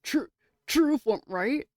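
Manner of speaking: high, loud disbelief; shocked. Real, not acted. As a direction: exclaim it in shock. Shocked repeat of line.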